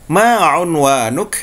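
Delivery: slow, careful Fusha "Ma unwanuk"; formal Arabic. Shown as a demonstration. Teacher modelling standard Arabic for students.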